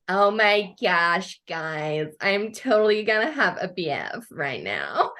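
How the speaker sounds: mock excited American teen accent.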